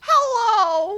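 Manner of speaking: put-on high, loud old lady greeting; squeaky little granny hello; grandma voice. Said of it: "grandma voice".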